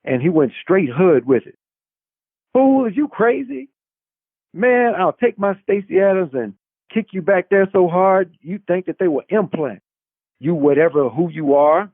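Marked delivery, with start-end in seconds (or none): 2.5-11.9 high, heated street talk; angry retort. A narrator's reading. Ace threatening Brock, high and heated. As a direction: snap back hard at the cop.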